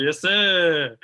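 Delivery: hyped cheer. Real, not acted. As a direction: stretch it out like a proud fan. Cheering for their old college.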